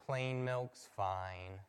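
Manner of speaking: slow, low, utterly let-down sigh of a line; deflated resignation. Retold or imitated. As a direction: give up on every hope, slowly and low. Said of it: "the epitome of disappointment".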